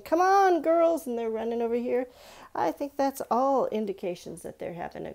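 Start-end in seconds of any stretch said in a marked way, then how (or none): 0.0-1.0 put-on high, loud call to the girls. Boy in a painting calling the girls to come play. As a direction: shout it across the playground. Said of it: "and he's saying".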